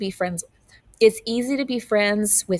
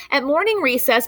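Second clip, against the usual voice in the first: usual speaking voice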